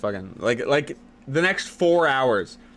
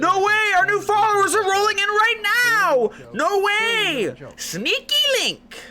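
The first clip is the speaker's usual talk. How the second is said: much higher, louder squeal; yelling; 5 dB louder, slower than usual. Real, not acted. Celebrating new followers rolling in, high loud yelling. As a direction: shout it out in a high falsetto. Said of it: "Sorry, I'm yelling Sneaky Link".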